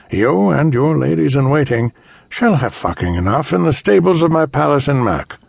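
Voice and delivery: sneering cruel threat; cold rasping evil emperor. Acted. Emperor insults them.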